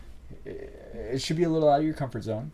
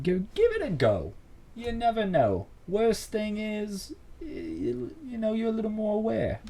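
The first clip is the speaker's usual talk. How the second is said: put-on English accent; 10 semitones higher than usual. Host urging listeners to try it, in a high mock English accent. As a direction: slip into a playful English accent, pitched well up. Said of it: "don't know why I did the accent".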